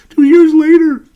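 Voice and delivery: high, loud shout of disbelief; exasperated shout. Real, not acted. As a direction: yell it out in pure frustration. Exasperated over wasted draft picks.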